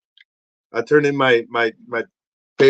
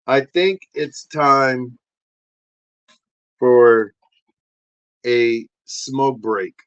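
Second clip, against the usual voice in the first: slow announcement; 2 semitones lower, 4 dB louder, much slower than usual. Acted.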